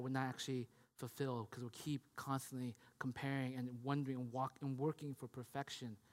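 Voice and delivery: low, soft, grave sermon delivery; hushed preaching. Real, not acted. Preacher lamenting broken families to the congregation, hushed and grave. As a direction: bring it right down, with weighty pauses.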